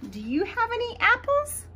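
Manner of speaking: ordinary delivery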